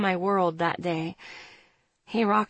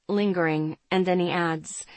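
ordinary delivery

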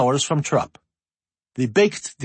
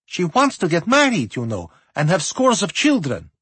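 put-on higher, playful teasing girl; 5 semitones higher than usual. A narrator's reading. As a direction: tease her sister brightly. Teasing sister.

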